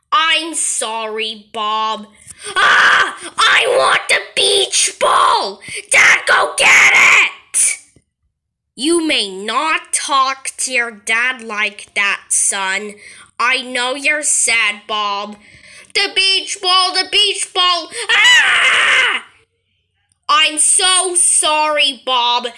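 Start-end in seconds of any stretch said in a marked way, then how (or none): 2.5-7.8 higher, louder wailing demand; crying tantrum shout. Acted. Kid wails at dad to fetch the ball, crying shout. 15.9-19.2 higher whine that breaks into a shriek; whine building to scream. Acted. Kid grieves his lost beach ball, whine into shriek.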